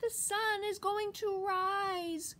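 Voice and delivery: high, drawn-out squirrel voice; piping little squirrel. A narrator's reading. Little squirrel answers that the sun will rise, piped up high. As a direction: pipe up high and slow as a small squirrel. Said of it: "little brown squirrel piped up".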